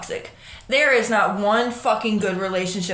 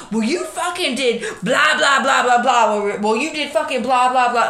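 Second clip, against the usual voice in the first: raised, louder bickering quote with blah blah; mock argument; 3 semitones higher, 4 dB louder than usual. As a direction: throw the old fight back at them, half mocking. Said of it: "and you're like".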